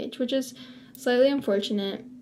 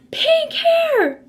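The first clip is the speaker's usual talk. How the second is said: put-on very high, very loud child's shriek; little girl announcing the vlogger's pink hair, shrieked high and loud; kid screaming; 9 dB louder than usual. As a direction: belt it out like a thrilled little girl. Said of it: "screams it so loud".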